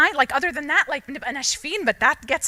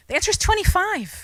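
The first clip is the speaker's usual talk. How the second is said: high, insistent know-it-all parent; mock parent explaining homework; 9 semitones higher, 4 dB louder, faster than usual. Parent pushing the answer on a confused child, high and insistent. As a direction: lecture it from above, exasperated.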